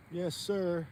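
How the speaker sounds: ordinary talk, nothing marked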